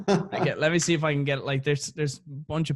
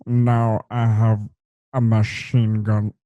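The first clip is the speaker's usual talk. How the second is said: lower, slow, louder villain drawl; mock Die Hard villain quote; Alan Rickman impression; 6 semitones lower, 5 dB louder, slower than usual. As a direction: savor each word like Hans Gruber. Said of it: "there's a certain quote that I want".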